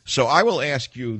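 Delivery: ordinary delivery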